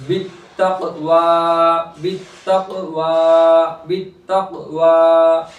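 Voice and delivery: बित्तक़वा with the final वा held long; slow बित्तक़वा, drawing out the last आ; stretched long vowel. Shown as a demonstration. Teacher shows how to stretch one alif, final वा of बित्तक़वा held long. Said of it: "तो एक अलिफ के बराबर खींचेंगे".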